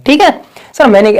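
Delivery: ordinary talk, nothing marked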